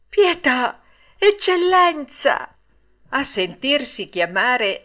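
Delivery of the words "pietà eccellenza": very high, loud, drawn-out plea; pleading. A narrator's reading. Pinocchio tries flattering the puppet master with titles, high and pleading. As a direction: plead loudly, stretching the words. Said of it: "con voce supplichevole".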